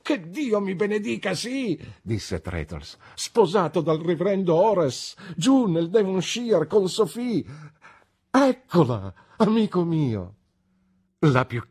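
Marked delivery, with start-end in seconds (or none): 0.0-1.8 very high, elated exclamation; joyful confirmation. A narrator's reading. Traddles confirms to his friend he married, elated. 3.1-10.4 high, excited, proud telling; elated announcement. A narrator's reading. Traddles presents his bride to his friend, elated.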